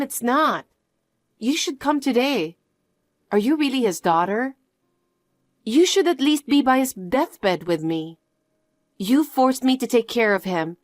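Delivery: ordinary delivery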